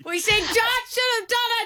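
busted prankster's high-pitched outburst; high squeal. Real, not acted. Prank caller found out, shrieking.

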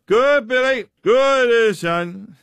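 high-pitched heckler's cheer, drawn out; imitating a drunk heckler yelling from the crowd; drunken shouted encouragement. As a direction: shout out like a tipsy, overexcited fan. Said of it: "he kept shouting out".

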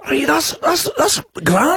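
high, hammy Scottish voice; Scottish accent. Pretend. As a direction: put on a big Scottish accent. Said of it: "we were doing Scottish accents before".

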